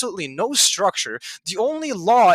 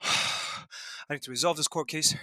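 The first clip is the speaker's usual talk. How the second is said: low, soft, winded after exertion; spent; 8 semitones lower, 8 dB quieter than usual. Real, not acted. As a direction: sigh it out quietly, drained and catching your breath. Worn out after rant and push-ups.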